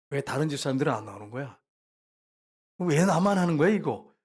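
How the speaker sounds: mildly indignant complaint, building in force; imitated rising resentment. Deacon resents cleaning church alone, mock rising anger. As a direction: act out a grumble turning to annoyance. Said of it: "갑자기 막 분노가 막 올라오더래".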